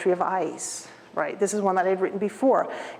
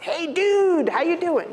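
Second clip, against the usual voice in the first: much higher, loose casual greeting to a pal; easygoing dude voice, pitched up; Hey dude voice; 8 semitones higher than usual. Retold or imitated. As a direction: greet your buddy, laid back. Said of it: "So this is my "Hey, dude" voice".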